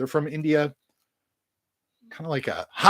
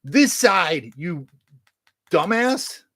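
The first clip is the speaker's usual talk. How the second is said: higher, half-shouted telling-off; mock scolding; 3 semitones higher, 4 dB louder, much slower than usual. Vlogger pretending viewers scold him, mock exasperated. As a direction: scold yourself like a fed-up friend. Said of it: "Should have been like".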